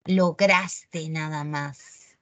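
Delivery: slow, careful "Lograste" with no final s; correct form. Shown as a demonstration. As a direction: model "Lograste" slowly, ending cleanly on te. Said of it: "Nunca lleves a ese horrorosa al final".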